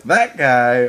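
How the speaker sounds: loud, mock excited call-out; imitating own excited outburst; excited recognition. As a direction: blurt it out like spotting a familiar actor. Acts out spotting actors mid-movie.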